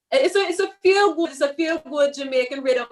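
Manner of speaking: light attempt at a Jamaican accent. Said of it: "Listen, I tried".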